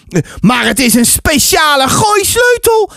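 put-on high, eager child's protest; insistent boy. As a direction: argue back like a keen boy. Boy argues for his brick.

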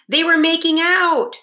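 high, loud gleeful announcement; announcing. A narrator's reading. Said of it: "announced the Mexican donkey".

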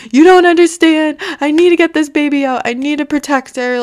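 high-pitched frantic plea, re-enacted; retold freak-out. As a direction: plead desperately, voice shooting up then easing. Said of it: "freaking the fuck out".

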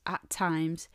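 ordinary talk

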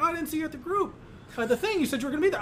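high, teasing mock reproach; mock accusing. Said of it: "you're like".

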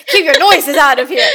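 laughing through the correction. Real, not acted. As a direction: repeat it while cracking up.

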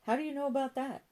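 high-pitched, taken-aback question; startled question. A narrator's reading. Charlie questioning Joan about his brother's drugs, startled. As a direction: pitch it up in acted surprise.

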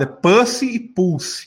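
slow "Pussy" with an "â" vowel, then "Pulse" with an "u"; contrasting pronunciations. Shown as a demonstration. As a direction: set "Pussy" against "Pulse", drawn out. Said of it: "É duas pronúncias diferentes".

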